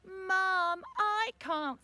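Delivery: put-on high, loud child's whine; whiny little boy complaining; whiny complaint. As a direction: wail mum's name as a drawn-out whinge. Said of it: "stuck his chin out and he said".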